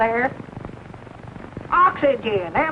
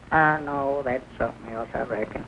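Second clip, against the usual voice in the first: much lower and softer, backing down; sheepish mumble; 8 semitones lower, 6 dB quieter than usual. Acted. Corrected by the doctor, mumbling sheepishly. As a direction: mumble the retreat under your breath.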